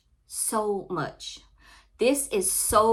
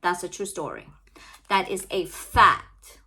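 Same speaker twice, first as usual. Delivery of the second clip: normal talking voice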